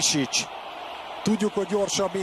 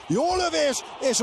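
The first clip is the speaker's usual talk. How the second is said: sudden high exclamation; excited; 11 semitones higher than usual. Real, not acted. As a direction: jump up at the shot. Good shot in play-by-play.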